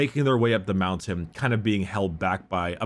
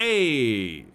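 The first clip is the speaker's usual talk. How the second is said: drawn-out mock Italian greeting; wrong accent, Italian. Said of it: "Wrong accent".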